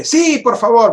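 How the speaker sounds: urgent, pleading yes; eager plea. Retold or imitated. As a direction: act out jumping at the offer. Acts out eager answer on phone.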